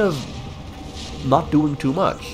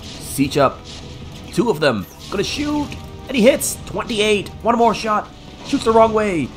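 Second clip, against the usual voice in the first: excited esports caster; 11 semitones higher than usual. Real, not acted. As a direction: call every shot live. Live play-by-play of tank shots.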